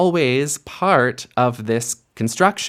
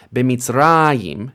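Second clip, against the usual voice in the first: B'Mitzrayim with the beat on "ra"; B'MitzRAyim, last syllable unstressed; stress on RA; 3 dB louder than usual. Shown as a demonstration. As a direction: lean on the "ra" of B'Mitzrayim, not "yim". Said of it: "stress on that, uh, on that last vowel of the stem".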